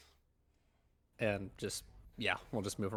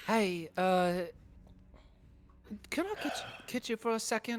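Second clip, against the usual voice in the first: pitched up, awkward request; awkward high voice; 10 semitones higher than usual. Acted. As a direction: ask sheepishly, voice up high. Asks companion to come along.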